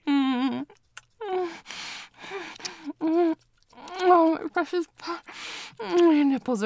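passionate moaning. Acted. Lover at the peak of passion with partner, loud moaning. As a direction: let the passion run over, loud and high. Passionate climax of intimate scene.

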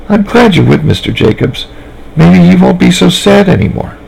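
put-on high, sweet small-girl voice; Sally chatting innocently to the nice lady; little girl voice. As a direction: talk like a trusting little girl.

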